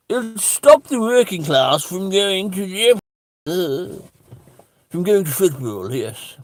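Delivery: drawn-out, high posh voice; mock posh accent. Comedian mimicking lockdown supporters, slow mock posh accent. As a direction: sneer it in an affected posh accent.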